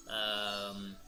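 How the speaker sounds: ordinary talk, nothing marked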